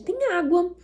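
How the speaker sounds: put-on high, bright-eyed offer to a child; enthusiastic offer. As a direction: pitch it up as an exciting find. Oferece alternativa animada à criança. (oferta animada, em tom agudo)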